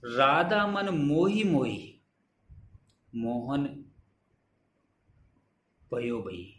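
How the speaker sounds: slow, measured recital of the verse; slow chanted recitation of a couplet; poem recitation. Shown as a demonstration. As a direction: lay the line out slowly like poetry. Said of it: "दूसरी पंक्ति".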